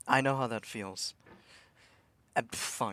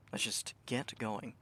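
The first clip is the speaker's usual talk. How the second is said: usual speaking voice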